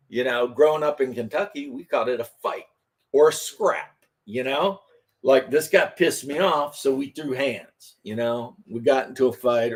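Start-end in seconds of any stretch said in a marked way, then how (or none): none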